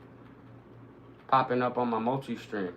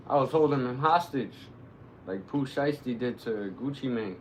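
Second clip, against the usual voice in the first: ordinary talk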